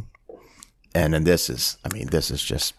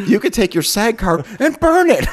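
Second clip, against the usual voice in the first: high, quick rant rising to a louder finish; mock furious tirade; 4 dB louder than usual. Producer telling off his assistant in front of police, mock fury. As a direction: play a boss ending a career, hammed up. Acting Lee berating Elliot.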